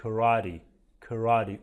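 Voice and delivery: American "karate" as کراتی, flapped t; correct American pronunciation. Shown as a demonstration. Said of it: "اما تلفظ صحیحش".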